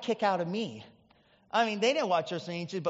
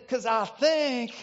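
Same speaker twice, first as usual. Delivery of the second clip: put-on Ernest Angley's accent; 3 semitones higher than usual. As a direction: mimic a Southern TV preacher making a diagnosis. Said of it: "And Ernest Angley said".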